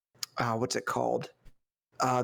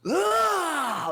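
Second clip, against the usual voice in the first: high, sharp mock samurai cry of pleasure; samurai shout; 3 dB louder than usual. Samurai gulping sake, hammed-up satisfied cry. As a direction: belt out a hammy warrior's "Ah!". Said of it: "he's like".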